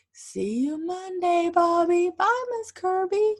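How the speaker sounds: high, loud sing-song farewell; cheery goodbye. Acted. Bobby and teacher say goodbye, high and loud. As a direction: call it out happily.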